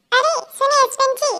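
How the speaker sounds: wife calling her husband back as he leaves, teasing. Acted.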